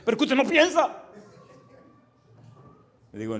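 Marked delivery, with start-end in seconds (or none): 0.0-1.0 imitated outburst, much higher pitched; mock exasperated scolding. Atheist caller scolding the pastor, mock exasperated. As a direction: scold like the fed-up caller, high-voiced.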